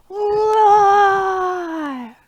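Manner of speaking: big loud high roars; lion roar. Acted. Teacher roaring along in the song, loud roar. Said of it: "you get to roar".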